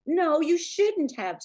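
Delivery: high, loud, slow rebuke; sharp retort. A narrator's reading. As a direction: throw it back at him, high and loud. Sally hitting back.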